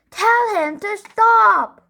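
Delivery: very high, loud and slow; both kids howl at once; screamed. A narrator's reading. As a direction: brothers screaming for parents. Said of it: "screamed Henry and Peter".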